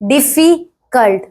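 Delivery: डिफिकल्ट broken as "diffi - cult"; slow डिफिकल्ट with a gap before "cult"; split into syllables. Shown as a demonstration. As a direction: sound out डिफिकल्ट in separate chunks. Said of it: "syllables ऐसे होता है".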